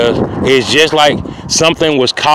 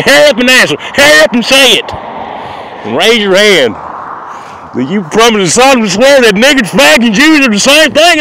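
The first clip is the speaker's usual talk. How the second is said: high, pushy mock voice of the klan men; high-pitched hammed-up klan voice, hurrying him; pushy klansmen; 8 semitones higher than usual. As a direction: rush him like an impatient klansman. Klan members pressuring him to answer.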